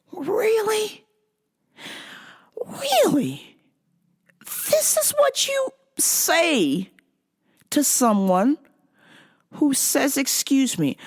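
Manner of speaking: high, slow disbelieving "Really? Really?"; incredulous retort. Retold or imitated. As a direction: stretch it out in high-pitched disbelief. Indignant retort to a stranger.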